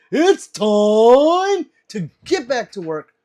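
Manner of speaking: slow, high, loud hammed-up order; slow, loud mock boss calling time. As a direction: drag it out like a pretend foreman. Reads a chat line, put-on voice.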